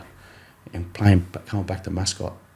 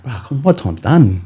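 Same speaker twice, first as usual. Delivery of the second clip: louder, higher plea to come off; a tired player pleading with his coach, loud and exasperated; exasperated protest; 6 semitones higher, 12 dB louder than usual. Retold or imitated. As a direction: beg the coach to let you sit.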